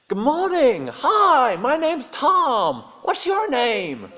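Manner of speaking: very high, loud, gushing American voice; booming cheery tourist greeting the waiter. Retold or imitated. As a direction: ham up an overfriendly tourist.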